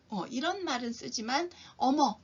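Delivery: ordinary talk, nothing marked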